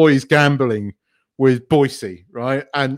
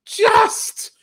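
very high, emphatic delight; excited raving; 14 semitones higher, much slower than usual. Real, not acted. Fan recalling a favourite sitcom episode, excited. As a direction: squeal the praise, stretching it out.

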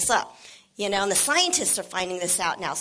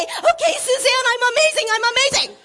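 mock shaken listener; 5 dB louder than usual. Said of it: "shake you until you say".

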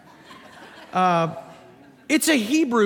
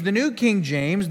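ordinary talk, nothing marked

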